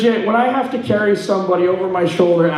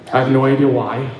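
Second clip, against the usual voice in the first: ordinary talk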